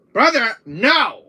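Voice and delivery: loud, high, drawn-out protest; slow, stretched-out shout; desperate forbidding. Acted. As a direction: yell it slowly to stop your brother. Forbidding the brother to go.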